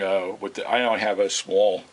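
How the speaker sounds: normal talking voice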